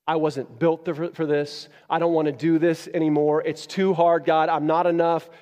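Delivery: a worn-out man begging God; Moses despairing. Acted. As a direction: Moses pleading with God, desperate. Moses voiced complaining to God.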